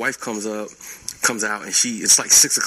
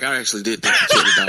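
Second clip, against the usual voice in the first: fast, laughing admission; laughing confession; 5 dB louder, much faster than usual. Retold or imitated. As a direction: blurt it out through a laugh. Wife admits, laughing.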